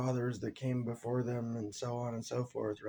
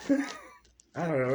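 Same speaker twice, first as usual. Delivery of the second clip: laughing; 4 semitones higher, 4 dB louder, slower than usual. Real, not acted. Laughs at own analogy.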